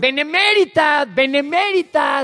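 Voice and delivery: benemérita drawn out slow and loud, mocking; mocking repetition. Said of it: "Y lo repite". (benemérita lenta y burlona, más aguda y fuerte)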